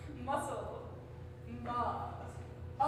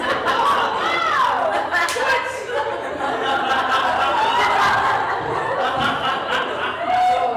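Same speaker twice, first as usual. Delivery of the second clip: long, very loud laughing fit; uproarious laughter; 13 dB louder than usual. Real, not acted. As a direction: burst out laughing, unable to stop. Cracks up over wrong guesses.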